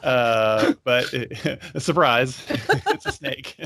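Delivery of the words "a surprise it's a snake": high laugh through the words. Real, not acted. Laughing at own joke.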